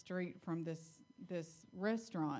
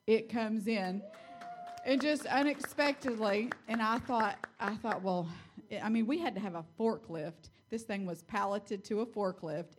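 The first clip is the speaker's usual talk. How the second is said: louder, rising with excitement; excited storytelling; 3 semitones higher, 6 dB louder than usual. Real, not acted. Wife recounting the bench's arrival, thrilled and loud. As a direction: tell it like the surprise just happened.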